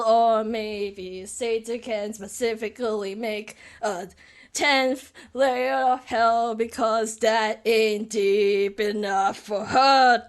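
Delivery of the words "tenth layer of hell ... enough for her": drawn-out, slow spite; slow taunt. Acted. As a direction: stretch every word. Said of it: "I don't know how to voice act".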